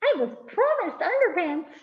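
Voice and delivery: high squeaky little mouse voice; tiny mouse. A narrator's reading. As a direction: pipe up like a teeny mouse, very high. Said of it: "the little teeny tiny mouse says".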